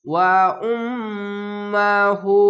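"ওয়া উম্মাহু" with ম্ম held as a nasal hum; very slow chanted "ওয়া উম্মাহু", humming the ম্ম; obligatory ghunna. Shown as a demonstration. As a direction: linger in the nose on উম্ম, stretch হু. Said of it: "তার জন্য ওয়াজিব গুন্না হচ্ছে".

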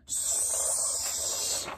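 usual speaking voice

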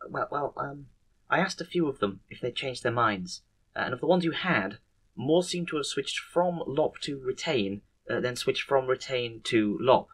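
soft, halting, stammered delivery; hesitant report. Acted. Nurse reporting back on the managers' votes, soft hesitant voice. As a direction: pick through it timidly with ums and restarts.

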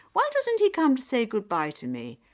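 acted anger, forceful and loud; angry. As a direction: let them hear how offended you are. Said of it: "Nyap said angrily".

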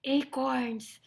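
mildly scornful lion; disdainful sniff. Acted. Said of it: "sniffed Lionel".

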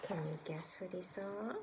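put-on soft whisper to the child; whispered question. A narrator's reading. Reader whispering a teasing question to the child. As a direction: lean in and whisper. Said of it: "asking child in suspense".

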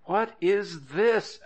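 slow, high-pitched demand; drawn-out judicial questioning; stern. A narrator's reading. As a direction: question it slowly like a mildly stern judge. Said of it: "asked the judge sternly".

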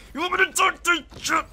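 high, loud mocking shout; taunting shout. Real, not acted. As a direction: hype it up as a taunt. Taunting teammates.

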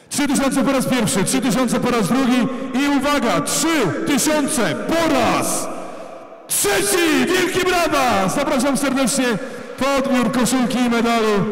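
slow build to a high shouted finish; auctioneer. Real, not acted. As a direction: drag it out then bang the hammer. Closes the sale.

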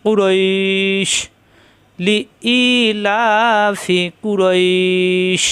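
slow drawn-out tajweed chant of লি ইলাফি কুরইশ with the long vowels stretched; Quranic recitation. Shown as a demonstration. Teacher reciting to students, slow drawn tajweed. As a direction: chant কুরইশ slowly, holding the ই long before the stop. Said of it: "জিব্বার মাঝখানে উঠে চাপ দিয়ে।".

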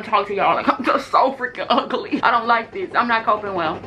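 muffled behind palm; talking through hand. Real, not acted. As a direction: hand covering mouth. Seen 0: Palm pressed over mouth throughout the labelled words, gone by +2.6.

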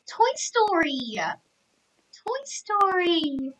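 high, long-drawn delighted cry; gasp, then sing out each word high and long; giddy fan squeal, stretched out; thrilled exclamation. Real, not acted. Spots Toy Story area in game.

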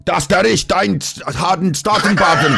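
a hammed-up fake German accent, laughing at the end; playing a German speaker badly, half-laughing; mock German. Answering how it's said in German, in mock German. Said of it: "How is it pronounced in German?".

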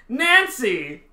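"Nancy" called high and loud in a put-on British accent. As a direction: shout "Nancy" as a British stage actor. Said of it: "I'd be like".

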